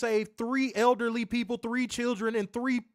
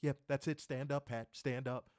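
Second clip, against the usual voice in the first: mock commentator in a lightly lower, softer voice; dropped voice; 5 semitones lower, 9 dB quieter than usual. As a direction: imitate a solemn commentator, quieter. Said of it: "He dropped his voice and everything".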